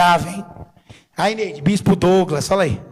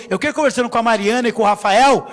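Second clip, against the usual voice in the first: normal talking voice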